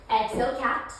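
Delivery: normal talking voice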